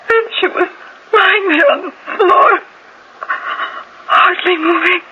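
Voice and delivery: crying out the words, voice cracking; the memory overwhelms her mid-confession; breaking down. Acted. Wife confessing the killing to the detective, sobbing.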